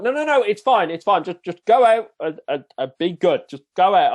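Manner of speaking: mock the examiner brushing it off, high and loud; imitating a flustered examiner. Voicing the examiner reassuring him.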